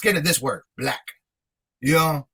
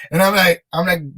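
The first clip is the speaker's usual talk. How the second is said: ordinary talk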